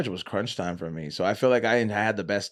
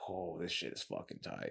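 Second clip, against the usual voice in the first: dazed murmur; 4 semitones lower, 18 dB quieter than usual. Retold or imitated. High on nitrous, dazed.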